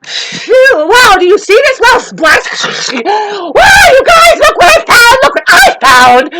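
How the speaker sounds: mock shrieking excitement, high and very loud; over-the-top gushing. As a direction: squeal like an attention-seeker showing off a find. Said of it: "We don't need somebody going".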